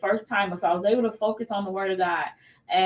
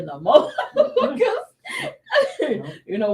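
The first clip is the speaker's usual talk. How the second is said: laughing; 7 semitones higher, 3 dB louder than usual. Real, not acted. Laughing about moving out.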